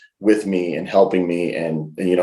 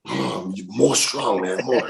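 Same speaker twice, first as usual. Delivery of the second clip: slow, lightly imitated friend urging him on; Bad Luck Fale; 2 semitones higher, 3 dB quieter, slower than usual. Wrestler urging his friend on in a taxi, slow impersonation. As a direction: drag it out in a put-on voice. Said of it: "Fale is like".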